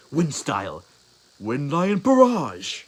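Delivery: loud call building to a high, drawn-out yell; shouted attack. Acted. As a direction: build up and belt out the move. Clone calls out its attack.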